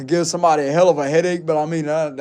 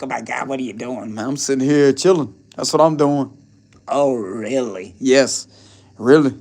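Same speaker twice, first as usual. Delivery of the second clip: two put-on voices; 6 semitones lower than usual. Vlogger showing off his switched voices to viewers. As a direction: play both sides of the chat in odd voices. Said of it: "switched my voice to, like, two different accents".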